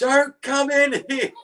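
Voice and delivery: very high, slow mock scream; drawn-out alarmed cry; retold scream. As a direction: imitate a panicked warning to a child. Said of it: "And I'm screaming".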